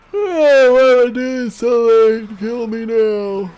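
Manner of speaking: high, loud, slow theatrical groan of defeat; mock despairing wail. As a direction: ham up a dramatic plea to be put out of your misery. Mock outburst at own late play.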